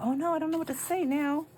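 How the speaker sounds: high-pitched retold "oh no"; mock dismay. Shopper who answered no without thinking, mock dismay. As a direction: play up being stuck for words, high. Retelling her own panicked thought.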